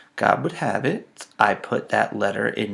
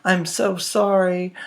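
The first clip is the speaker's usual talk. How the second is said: high, slow, sugary sorry; mock-sweet girlfriend apology; girlfriend's apology; 8 semitones higher than usual. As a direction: put on her pleading voice. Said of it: "And she's like".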